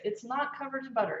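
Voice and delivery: usual speaking voice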